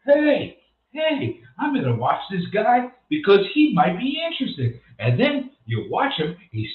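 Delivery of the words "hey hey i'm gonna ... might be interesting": mock viewer voice. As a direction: play the eager viewer, hammed-up. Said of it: "and you say".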